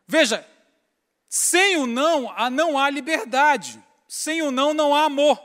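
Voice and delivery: loud, slow, high preacher's cry; full-force pulpit preaching; fiery preaching. Acted. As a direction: preach it out to the whole church.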